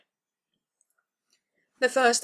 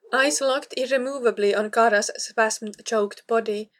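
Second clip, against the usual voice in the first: usual speaking voice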